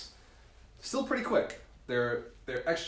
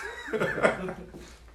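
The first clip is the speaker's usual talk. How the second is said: squealing high laugh; real high laugh; 4 dB louder than usual. Real, not acted. Speaker with the audience, squealing laugh.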